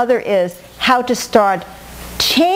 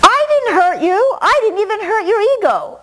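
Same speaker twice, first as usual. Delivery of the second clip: much higher, whiny voice of someone offended; indignant protest; 10 semitones higher than usual. Retold or imitated. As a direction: whine it like a wounded pride. Voicing confronted person's protest.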